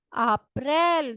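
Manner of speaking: अप्रैल slow, open e; Russian aprel. Shown as a demonstration. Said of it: "Russian language में कहते हैं अप्रैल". (अप्रैल को धीरे बोलें)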